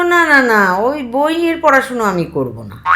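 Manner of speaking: put-on high, loud childish refusal; protesting little child. Little brother refusing picture books from uncle, high protest. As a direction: refuse like a stubborn small boy.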